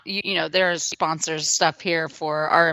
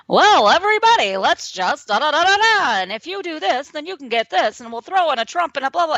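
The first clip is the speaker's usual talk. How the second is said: much higher, louder hammed-up infomercial pitchman; 9 semitones higher, 5 dB louder than usual. Host parodying Canadian boasts as an infomercial pitchman. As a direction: oversell it like a cheesy TV pitchman. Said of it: "I kind of hear it in that voice".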